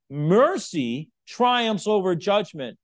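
Mercy triumphs over judgment, slow, loud and forceful. Acted. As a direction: preach it with full force. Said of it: "he says it so forcefully".

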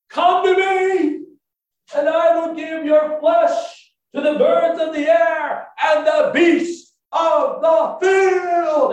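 very high, slow, booming shout; bellowed threat. Acted. Goliath threatens David before both armies, bellowing slowly. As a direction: stretch every word into a huge yell.

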